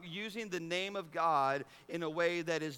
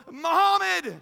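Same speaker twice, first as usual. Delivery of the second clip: mock yell; 12 semitones higher, 15 dB louder than usual. Preacher imitating fan whose receiver drops the ball, yelled. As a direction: holler it like a let-down football fan. Acts out cursing at dropped ball.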